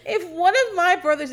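normal talking voice

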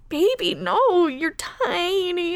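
put-on high, slow, soothing baby no; tender comfort. Talking to the child hero on screen, tender and high. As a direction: coo it to a small crying child.

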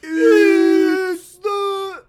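high, loud show-segment yell; hammed-up announcer call; announcer shout. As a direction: belt it out like a game show host.

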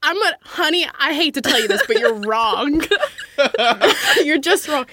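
laughing. Real, not acted. Teasing a friend.

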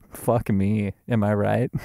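ordinary delivery

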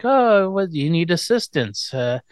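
put-on high, slow little-girl voice; whiny babyish plea; baby talk. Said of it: "Stop the baby talk".

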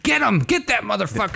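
high, excited cartoonish shout; Danny DeVito. Voicing an evil robot ordering the attack, high-pitched impression. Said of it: "let's go with the voice of".

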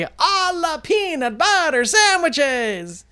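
slow, high wizardly incantation; drawn-out magician incantation; Mumford magic word. Retold or imitated. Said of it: "he would always say".

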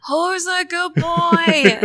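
slow, high doggy talk; dog-praising voice. Acted. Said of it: "the magic word to use the wand is".

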